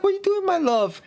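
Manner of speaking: very high, put-on sweetheart voice; mock-innocent question in falsetto; imitated doting lover. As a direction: coo it high to your darling. Undead lover as he aims gun.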